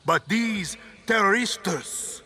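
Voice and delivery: dictator rallying the nation, building from whisper to shout; rousing political speech. Acted. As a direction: orate like a tyrant swaying a crowd.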